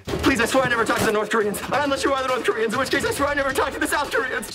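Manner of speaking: fast, high, frantic denial; racing high-pitched comic panic; panicked pleading. Acted. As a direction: gabble a desperate plea.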